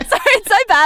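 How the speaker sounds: high, loud, breaking up with laughter; cracking up mid-attempt; laughing apology. Real, not acted. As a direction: apologise through a fit of laughter. Cracks up during accent attempt.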